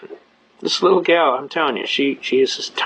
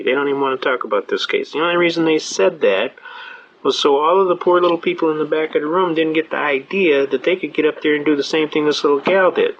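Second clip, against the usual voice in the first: ordinary talk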